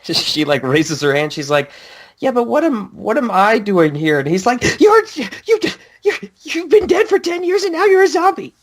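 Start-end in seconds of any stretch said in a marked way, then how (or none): 2.2-4.2 mock confused girl. Voicing heroine's question. 4.8-8.5 hammed-up anime villain; mock exasperated villain. Voicing villain's retort.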